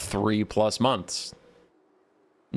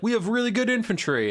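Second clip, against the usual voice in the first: proud boast; 10 semitones higher than usual. Retold or imitated. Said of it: "Norska's like".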